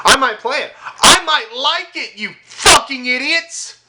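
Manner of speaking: rising to a high, loud yell; building angry rant. Real, not acted. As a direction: build from a taunt to a furious shout. Ranting at fans, building.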